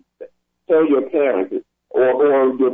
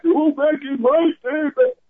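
much higher put-on grumpy old man; old guy voice; 14 semitones higher than usual. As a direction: croak it like a nostalgic old-timer. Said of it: "I wanna sound like some old guy".

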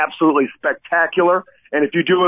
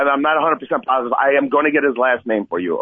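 ordinary delivery

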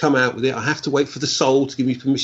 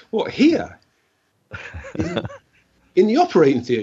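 "What, here?" jumping much higher in amazement; surprised; 10 semitones higher than usual. Retold or imitated. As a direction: sound floored by the idea. Astonished at request mid-surgery.

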